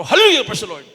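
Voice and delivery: very loud shout of praise. Retold or imitated. Pastor shouting praise as he is dragged off, very loud. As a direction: cry it out triumphantly. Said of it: "sumigaw ang Mala Pastor".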